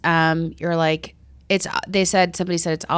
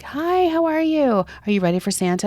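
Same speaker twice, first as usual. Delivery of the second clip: cheery stranger; 6 semitones higher than usual. Retold or imitated. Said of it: "he was like".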